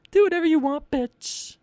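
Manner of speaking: very high cartoon squeak; high squeaky voice. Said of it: "I could do it that way too".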